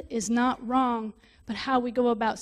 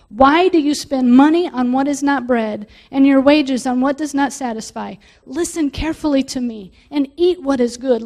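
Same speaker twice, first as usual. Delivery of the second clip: loud, fervent scripture reading; fervent sermon reading; preaching scripture; 6 dB louder than usual. Acted. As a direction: proclaim the prophet's call to the crowd. Reads Isaiah with preaching force.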